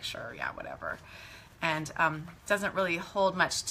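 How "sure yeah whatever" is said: very low, soft throwaway mumble; dismissive mock agreement. Storyteller imitates Iona brushing off grandmother's warning, dismissively. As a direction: brush it off under your breath.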